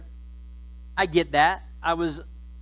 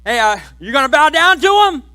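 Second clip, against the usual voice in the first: mocking brothers; 5 semitones higher, 8 dB louder than usual. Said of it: "They're like".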